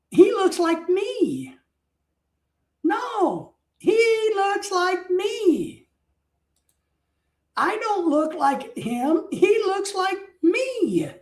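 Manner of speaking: hammed-up, drawn-out correction; emphatic insistence. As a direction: spell it out stubbornly, stretching each word. Acted stubborn correction of the staff.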